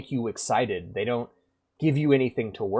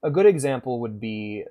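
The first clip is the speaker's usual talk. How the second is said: normal talking voice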